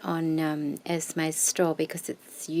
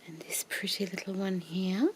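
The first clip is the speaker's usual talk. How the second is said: whisper=40; 6 dB quieter than usual. Real, not acted. Whispering over lace.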